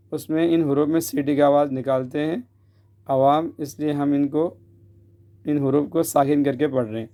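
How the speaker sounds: ordinary talk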